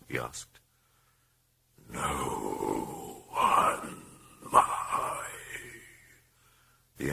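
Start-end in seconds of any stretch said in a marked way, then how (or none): 1.9-5.3 low, pained groan of a voice; groaning whisper. A narrator's reading. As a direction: groan the words out, hurting. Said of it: "groaning whisper".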